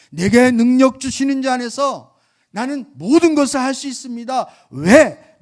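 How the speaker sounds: preached out high and loud; loud proclamation. Real, not acted. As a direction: ring it out to the congregation. Preacher proclaims scripture loudly. (높고 크게 선포하듯)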